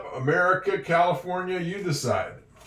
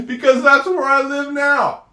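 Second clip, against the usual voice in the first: much higher and louder, still laughing; 10 semitones higher, 6 dB louder than usual. Real, not acted. Explaining the Florida joke to learners, still laughing.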